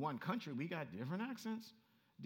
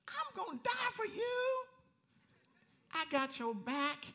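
high, drawn-out bold declaration; loud vow; 5 dB louder, slower than usual. Acted. Peter boasting loyalty to Jesus, loud high vow. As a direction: proclaim it high, slow and loud.

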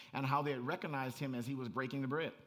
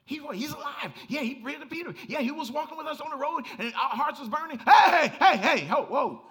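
higher and louder, building to shouted hey hey hey; preaching at full force; 10 semitones higher, 11 dB louder than usual. Acted. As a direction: preach it up to a roaring climax. Preaching climax, rising to shouts.

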